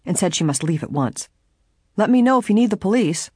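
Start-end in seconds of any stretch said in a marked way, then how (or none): 2.0-3.3 higher and louder call after someone. A narrator's reading. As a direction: call it out across the room. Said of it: "the officer called after her".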